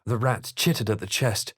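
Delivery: normal talking voice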